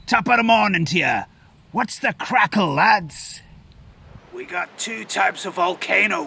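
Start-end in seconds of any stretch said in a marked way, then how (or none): 0.1-3.4 quick, higher mock Irish lilt; playful stage Irish greeting; Irish accent. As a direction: toss it off like a cheery Irishman. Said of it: "a good old Irish one".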